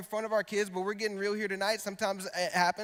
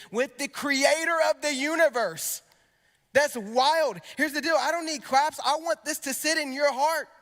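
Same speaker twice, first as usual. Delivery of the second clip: rising preacher fervor; 6 semitones higher, 4 dB louder than usual. Real, not acted. Preacher building to a peak.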